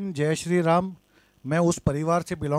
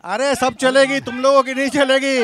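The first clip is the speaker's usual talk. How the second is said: raised, high-pitched heckling; angry shouting; 5 semitones higher, 5 dB louder than usual. Real, not acted. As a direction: shout defiantly at the chanting protesters. Shouting back at protesters.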